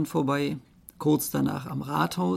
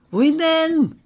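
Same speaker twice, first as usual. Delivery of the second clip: high, loud monkey question; 12 semitones higher, 8 dB louder than usual. Acted. Monkey asks where to flee, high and loud.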